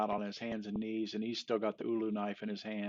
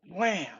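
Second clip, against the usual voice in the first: table slam; 9 semitones higher, 6 dB louder than usual. Retold or imitated. Slams jar on table.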